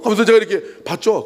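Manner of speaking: ordinary delivery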